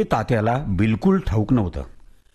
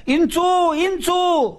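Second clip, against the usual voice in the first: much higher alarmed cry; women crying out; much slower than usual. Acted. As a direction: shout the warning. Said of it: "बायका ओरडू लागल्या".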